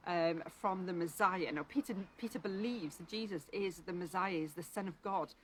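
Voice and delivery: normal talking voice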